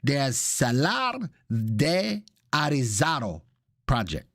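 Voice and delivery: slow, showy Spanish pronunciation; hammed-up Spanish accent; put-on Spanish accent. Shown as a demonstration. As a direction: draw it out in a playful Spanish accent. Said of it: "I go from Italian to Espanol".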